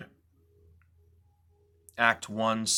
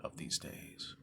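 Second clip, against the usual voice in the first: whisper; 13 dB quieter than usual. Acted. Menacing end of soliloquy.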